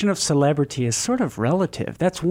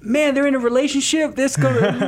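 high and excited; big excited reaction; 11 semitones higher than usual. Real, not acted. Excited banter about the couple.

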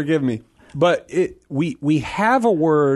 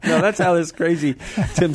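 laughing; 6 semitones higher than usual. Real, not acted. Joking after laughter.

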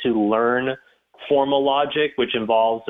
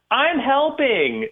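put-on high, slow little child announcing proudly; little kid voice; 12 semitones higher, much slower than usual. Dad retells his four-year-old in the kitchen, high slow kid voice. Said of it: "a four-year-old say".

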